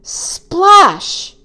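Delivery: high, bursting sound-effect word; exclaimed splash. A narrator's reading. Boy watching Otto go under, exclaiming. Narrator voices the second splash.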